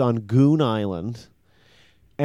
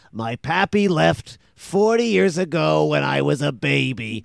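a half-laughing put-on sailor voice; mock tearful Popeye; 2 semitones higher, 3 dB louder than usual. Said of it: "and he says".

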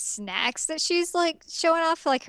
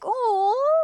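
touched gasp; 12 semitones higher, 4 dB louder than usual. Acted. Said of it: "And I was like".

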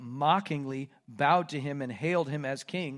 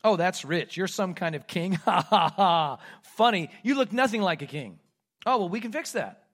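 jeering, with a loud mock laugh; derisive mockery; 5 dB louder than usual. Roman soldiers deride their prisoner as some kind of king, mockingly. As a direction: make fun of a pretend king. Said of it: "let the derision begin".

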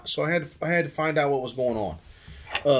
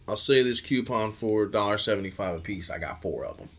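low quiet Sammy pitch; 8 semitones lower, 5 dB quieter, faster than usual. Retold or imitated.

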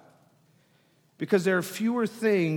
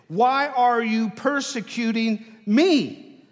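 slow, loud question landing on "me"; drawn-out emphasis on "me"; stress on me; 5 semitones higher, 8 dB louder, slower than usual. Acted. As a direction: build to a punched, high "me". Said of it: "The emphasis here is ... on the me".

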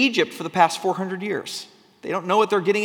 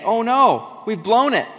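mock dismay; 5 semitones higher, 5 dB louder, slower than usual. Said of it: "Somewhere in here they say".

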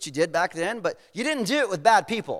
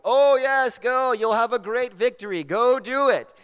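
loud, higher, mock-enthusiastic cheer; sarcastic; 3 semitones higher, 4 dB louder than usual. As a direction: feign big enthusiasm for a plan you know is doomed. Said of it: "Micaiah says sarcastically".

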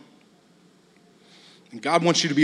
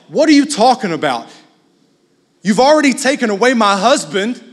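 indignant challenge, raised in pitch and volume; mock outrage building to a higher question; upset; 5 semitones higher, 5 dB louder than usual. Leah to Rachel over husband and mandrakes, upset. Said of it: "She's very upset".

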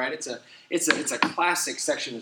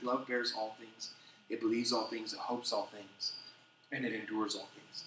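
soft reading; 5 semitones lower, 9 dB quieter than usual. Acted. Reading the love chapter to the church, soft and tender. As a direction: ease down tenderly.

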